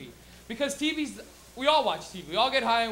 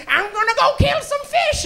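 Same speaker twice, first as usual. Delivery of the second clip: high, slow loony granny voice; crazy old lady; 13 semitones higher, 9 dB louder, slower than usual. Retold or imitated. Cartoon grandma announcing her plan, high loony voice. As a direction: sing it out like a batty grandma.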